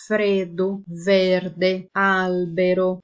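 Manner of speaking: "Freddo, verde, albero" with closed E; closed E examples. Shown as a demonstration. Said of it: "Depois eu tenho sem acento". (E fechado em "Freddo, verde, albero")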